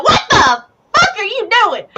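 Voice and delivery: pained outraged yell. Acted. Punched player yells at the game master, outraged. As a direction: cry out after the punch.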